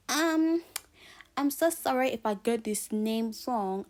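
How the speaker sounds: high, loud, hesitant apology; teacher at register. Acted. Teacher unsure of a pupil's name at register, mock apologetic. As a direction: put on an awkward teacher taking the register. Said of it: "imagine the teacher at the register".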